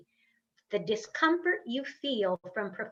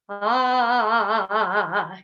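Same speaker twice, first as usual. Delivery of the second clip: loud open "ah", shaking in pulses; big-space "ah"; 2 semitones lower, 5 dB louder than usual. Shown as a demonstration. Coach kicking the foot, big wobbling "ah". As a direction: open wide and ring it out, wobbling. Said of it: "with big space".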